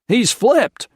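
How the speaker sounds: high, loud alarmed shout. A narrator's reading. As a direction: shout the alarm across the hut. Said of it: "Tex shouted".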